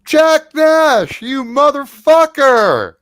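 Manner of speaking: high, loud, drawn-out holler; shouting across room. Acted. Somebody spots Jack Nash from across the room, shouting. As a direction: bellow it across a crowded bar. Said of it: "you hear somebody shouting from across the room".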